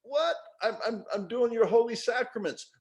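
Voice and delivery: high, indignant protest; baffled worshipper. Retold or imitated. Worshipper protesting to God, high and indignant. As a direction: squeak out a shocked defence. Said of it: "You're like".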